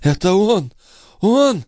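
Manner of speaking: very high, agitated woman's voice; high exclamations, climbing on the repeat; faltering babble. A narrator's reading. Sister recognises her attacker, high agitated babble. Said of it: "пролепетала Андреа".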